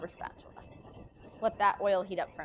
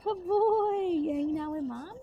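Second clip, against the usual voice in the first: high, louder doggy praise; talking to a dog; 6 semitones higher, 6 dB louder, slower than usual. Real, not acted.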